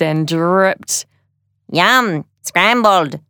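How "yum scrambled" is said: lightly voiced proud little robot. A narrator's reading. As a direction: show off your handiwork as a robot. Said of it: "said Tidybot proudly".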